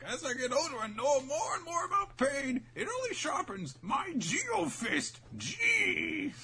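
put-on high, slow, strained old man; hammed-up high elderly sage; old master voice. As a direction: creak it out like an ancient kung fu master. Voicing old master Lao Ji.

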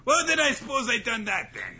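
high, loud hammed-up pirate; two hosts trading jokes as pirates. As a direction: ham it up like a cartoon buccaneer. Pirate banter continues.

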